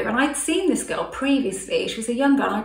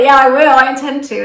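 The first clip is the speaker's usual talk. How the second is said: loud, higher, emphatic promise; louder firm intention; emphatic agreement; 4 semitones higher, 13 dB louder than usual. Retold or imitated. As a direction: declare it loudly.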